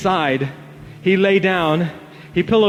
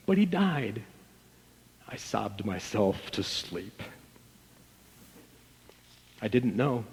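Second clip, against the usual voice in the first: sinking to a near-hush, lower; fading grief; 8 semitones lower, 13 dB quieter than usual. Acted. Narrator remembering the death, fading to a hush. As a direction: let the voice die away.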